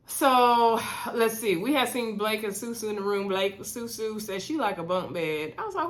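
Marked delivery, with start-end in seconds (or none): none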